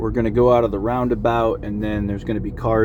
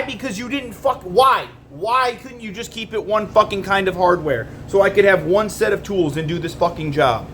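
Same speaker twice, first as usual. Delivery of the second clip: angry; 6 semitones higher than usual. Real, not acted. Ranting at manufacturer.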